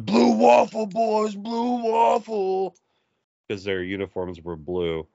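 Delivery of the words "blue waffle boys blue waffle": high, drawn-out sideline yell. Retold or imitated. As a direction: chant it from the sideline. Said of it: "would always yell from the sideline".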